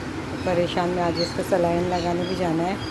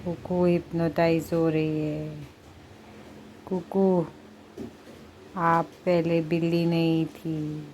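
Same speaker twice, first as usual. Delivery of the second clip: slow, drawn-out hypnotist voice; mock hypnotist; slower than usual. Mother pretends to hypnotize her cat, slow and lulling. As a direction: lull the cat as if putting it under.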